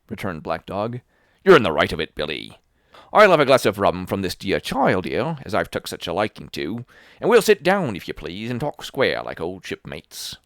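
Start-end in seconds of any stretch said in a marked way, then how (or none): 1.5-10.4 put-on loud, wheedling old sea dog; sly old sailor. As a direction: coax the boy like a fawning pirate.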